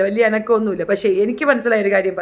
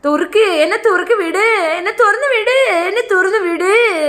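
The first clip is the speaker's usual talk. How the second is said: higher, louder, slowed crying plea; whiny coaxing cry; 8 semitones higher, 3 dB louder than usual. Retold or imitated. Locked-in girl pleading to be let out, mock whining cry. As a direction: whimper to be let out like a pampered child. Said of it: "ഇവളൊന്ന് ചെല്ലം കൊഞ്ചി നോക്കി".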